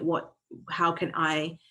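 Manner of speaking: usual speaking voice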